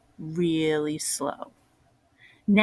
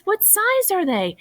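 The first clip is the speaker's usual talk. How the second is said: bright, much higher grandma voice; excited Grandma; 13 semitones higher, 3 dB louder than usual. Acted. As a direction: gush with delight over the find. Grandma eager at shoe store.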